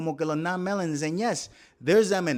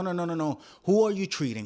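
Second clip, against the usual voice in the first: usual speaking voice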